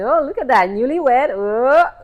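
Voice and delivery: excited, teasing admiration; gushing. Retold or imitated. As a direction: coo loudly over the happy news. Cooing over the newlywed news.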